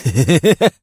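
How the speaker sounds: gloating snicker. Acted. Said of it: "мерзко и злорадно ухмыльнулся".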